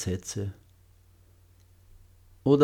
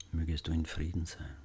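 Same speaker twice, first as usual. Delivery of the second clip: much softer, slightly lower repeat; hushed loving echo; 3 semitones lower, 9 dB quieter, faster than usual. Shown as a demonstration. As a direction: echo the wish very quietly. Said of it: "Wiederholen Sie die Sätze sanft und liebevoll".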